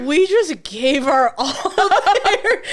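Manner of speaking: laughing. Retold or imitated. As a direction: act offended while giggling. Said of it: "I'd be like".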